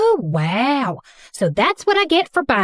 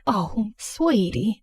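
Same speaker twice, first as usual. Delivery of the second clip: soft and slow, full of sympathy; hushed comfort, drawn out; tender; 6 dB quieter, much slower than usual. Acted. Comforting him after his story.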